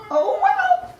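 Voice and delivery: very high squeaky oh well; shrugging hamster. Acted. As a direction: toss it off like a carefree cartoon hamster. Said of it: "Hamtaro muses".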